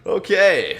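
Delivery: ordinary delivery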